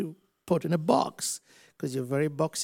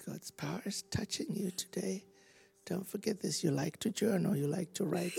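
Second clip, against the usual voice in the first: soft, higher, gentle voice; tender prophecy; 3 semitones higher, 9 dB quieter than usual. Real, not acted. Preacher softly telling the woman what God sees. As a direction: speak it softly like a secret.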